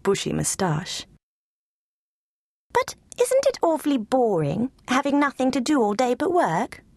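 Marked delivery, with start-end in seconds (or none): 2.7-6.8 lightly voiced high, curious lapdog; pampered lapdog voice. Lulu Bell quizzing Bones, high and curious. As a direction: ask it like a spoiled pet. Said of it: "she asked".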